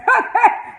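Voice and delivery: a high burst of laughter; loud laugh. Real, not acted. Reacting to Benny admitting it with a high, loud laugh. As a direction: cackle loudly at the answer.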